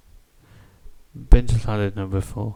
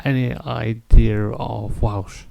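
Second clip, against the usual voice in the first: normal talking voice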